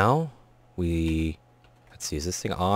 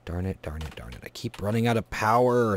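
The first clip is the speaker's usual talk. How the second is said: normal talking voice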